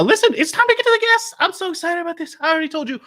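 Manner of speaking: very high-pitched excited announcing. Acted. Host announcing it is guest time, excited. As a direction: squeal it high, eager to begin.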